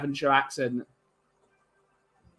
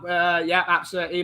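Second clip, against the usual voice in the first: ordinary talk, nothing marked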